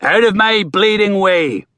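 ship captain's roar; bellowing at the crowd on the beach; roaring quarterdeck voice. A narrator's reading. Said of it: "roaring in his best quarterdeck voice".

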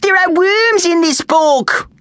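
high, drawn-out disgusted shout; crying out. A narrator's reading. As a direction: shout it in slow, high revulsion. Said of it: "cried Mandy".

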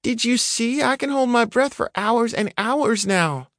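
put-on high, eager child's voice; little girl voice. A narrator's reading. Girl boasts to her father about holding her breath. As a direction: voice a proud little girl showing off.

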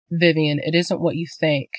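ordinary delivery

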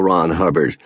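ordinary delivery, nothing marked

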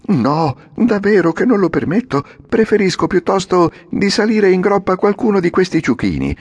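Pinocchio shouting. A narrator's reading. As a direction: insist with mild shout. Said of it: "gridò Pinocchio". (Pinocchio che grida, insistente)

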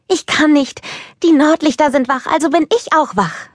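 put-on high, bubbly child voice full of eagerness; a wide-awake kid who can't sit still; excited little girl. Little Anna eager to play. (aufgeregtes kleines Mädchen, hohe Stimme)

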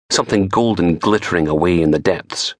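plain reading voice

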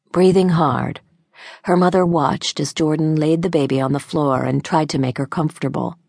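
usual speaking voice

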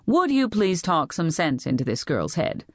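ordinary delivery

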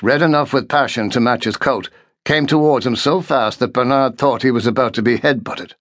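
ordinary delivery, nothing marked

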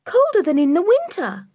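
put-on high-pitched child shivering with cold; high girlish complaint about the chill; cold young girl. A narrator's reading. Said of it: "her teeth beginning to chatter".

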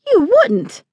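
high-pitched indignant disbelief; shocked exclamation. A narrator's reading. Young woman threatened with being carried out, shocked exclamation. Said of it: "she exclaimed".

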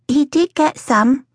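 put-on high, slow small-child voice; a small boy wanting reassurance; drawn-out, high toddler voice; little boy Toby. A narrator's reading. Said Toby, looking from face to face for reassurance.

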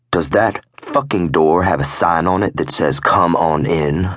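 plain reading voice

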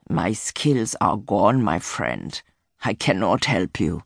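put-on low, slow, defeated old voice; weary old man. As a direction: confess it like a broken old man. Old hunter's unspoken confession.

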